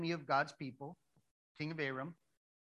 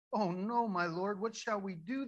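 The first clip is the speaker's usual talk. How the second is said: high, loud acted dismay; alarmed servant; 5 semitones higher, 5 dB louder than usual. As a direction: cry out to your master in mock panic. Servant sees the surrounding army.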